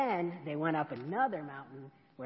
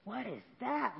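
wonder; 5 semitones higher than usual. Acted. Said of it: "said in wonder".

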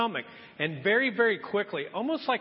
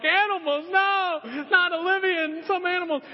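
high, louder horrified protest; horrified kids; 10 semitones higher, 3 dB louder than usual. Retold or imitated. Daughters protesting that animals might dig up their hamster, horrified. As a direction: cry out like upset little girls.

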